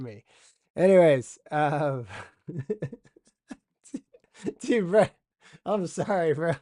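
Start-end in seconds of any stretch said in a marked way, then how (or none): 4.6-6.5 laughing apology. Real, not acted. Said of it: "why I'm laughing so hard".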